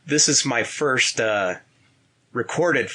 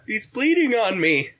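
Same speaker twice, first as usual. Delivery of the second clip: whiny complaining; 10 semitones higher than usual. Retold or imitated. Opponent whining quoted.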